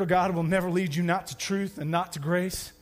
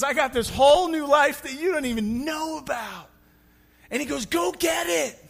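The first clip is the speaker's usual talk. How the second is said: higher and louder, nearly shouted; excited proclamation; 8 semitones higher, 4 dB louder than usual. Acted. Jesus telling the healed man, excited. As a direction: burst out with the good news.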